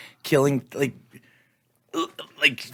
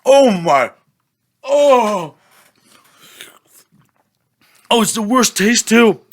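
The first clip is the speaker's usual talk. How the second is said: gasping at extreme sourness; pained cries while sucking candy; eating sour candy; 8 semitones higher, 5 dB louder than usual. Real, not acted. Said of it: "I'm soaked in sweat right now".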